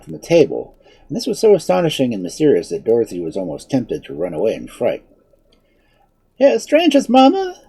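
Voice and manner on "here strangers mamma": put-on high, loud child calling out; shrill childish voice. A narrator's reading. Unseen child calling to mamma about strangers, shrill. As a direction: call out shrilly like a small child. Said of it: "called a shrill and childish voice".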